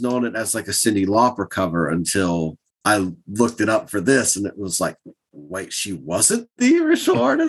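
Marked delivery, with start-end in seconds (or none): 5.3-7.5 high, laughing double take; mock shocked realization; surprised disbelief. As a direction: catch yourself in disbelief, giggling. Own past surprise, laughing.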